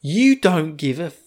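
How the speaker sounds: half-acted snap at her; imitating Shin's outburst. Shin snapping at the girl, mild mock anger. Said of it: "And Shin just loses his shit".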